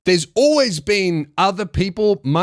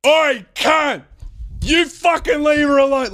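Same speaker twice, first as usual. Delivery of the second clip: raised, high-pitched yell across a platform; loud yell; 9 semitones higher than usual. Retold or imitated. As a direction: shout a rough challenge at a stranger. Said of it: "way louder than that".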